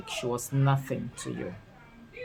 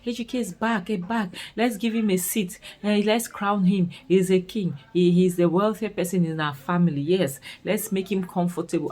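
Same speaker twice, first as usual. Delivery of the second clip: much higher, gushing relative crowning the rich man; mock fawning praise; 4 semitones higher than usual. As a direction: overdo the flattery like a court herald. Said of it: "They have to be happy".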